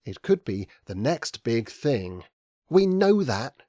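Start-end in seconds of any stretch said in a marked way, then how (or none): 2.7-3.6 higher, louder impatient cut-in; impatiently. A narrator's reading. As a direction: brush them off, hurry them along. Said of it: "said Indigo impatiently".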